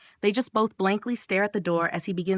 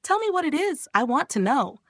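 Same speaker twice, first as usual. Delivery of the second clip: much higher, demanding interrogation; pushy yell; 8 semitones higher than usual. A narrator's reading. Friend demands answers from the guy, yelling. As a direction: press him like an interrogator. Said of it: "As her friend yells".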